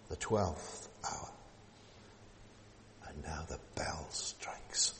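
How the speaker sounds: slow, whispered countdown; hushed whisper. Acted. Preacher counting the final hours to the congregation, whispered. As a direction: breathe it out, barely voiced.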